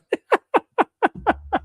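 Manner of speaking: high wheezing laugh; real high-pitched fit of giggles; helpless laughter. Real, not acted. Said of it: "He's laughing".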